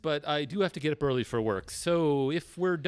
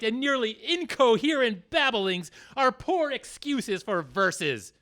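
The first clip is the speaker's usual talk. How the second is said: ordinary delivery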